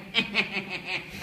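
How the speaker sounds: high comic cackle; character cackle. Acted. Fool pleased with his own rottenness, high cartoon cackle.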